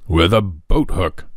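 slow, dry deadpan doctor; doctor teasing the boy with a straight face, slow and dry; grave doctor. A narrator's reading. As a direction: deliver the joke bone-dry and slow. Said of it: "the grave practitioner without showing the least sign of twinkle".

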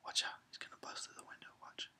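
hushed, breathless anticipation; real suspense whisper; tense whisper. Real, not acted. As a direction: whisper as the scare builds.

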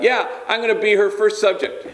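high, through a laugh; joking at his own expense; laughing dad. Retold or imitated. Bandit jokes about his daughter, laughing high.

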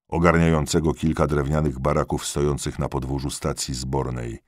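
ordinary delivery, nothing marked

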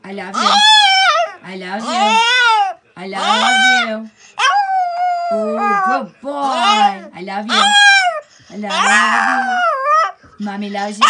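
method actor intensity. Pretend. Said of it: "You sound like a method actor doing that".

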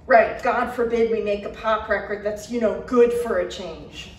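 mock the idea with contempt; sarcastic. A narrator's reading. Garrett mocking Michael's taste, sarcastic.

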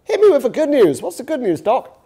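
hammed-up hopeful patient; eager patient. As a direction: bounce in, keen for the good news. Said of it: "And the guy says".

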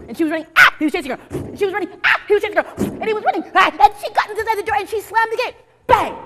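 loud, high, rising chase; frantic building chase. A narrator's reading. Storyteller to children, frantically shouting the chase.

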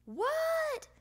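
high, loud shouted question; yelled reply. A narrator's reading. Froggy yells back to mom.

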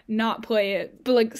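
ordinary talk

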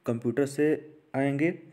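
ordinary talk

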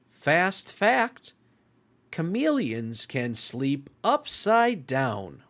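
bright, lively storytime read; picture-book reading voice for young listeners; reading to children. Acted. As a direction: read it like storytime for little ones. Reads picture book to kids.

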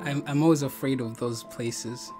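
ordinary talk, nothing marked

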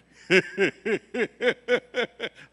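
high, loud cackling laugh; maniacal laugh. Retold or imitated. Bass player laughing beside him on stage, maniacal. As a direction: let out a wild, unhinged laugh. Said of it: "laughing like this maniacal laugh".

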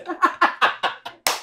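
high breathy giggling; real laugh. Real, not acted. Storyteller losing focus with the crew, high laughing. Cracks up at own remark.

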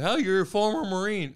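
high, loud acted-out taunt; mocking challenge. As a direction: throw it out as a loud, mocking quote. Acting out a confrontational quote.

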